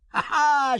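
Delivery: high piping witch cackle. A narrator's reading. Old witch mocks the king with a high cackle. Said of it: "she piped".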